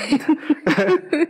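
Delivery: short burst of startled laughter; nervous laugh. Real, not acted. Laughing after a spooky noise.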